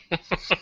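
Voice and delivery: soft, high chuckle. Real, not acted. Host fumbling for a cable, chuckling. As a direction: laugh softly at your own fumble.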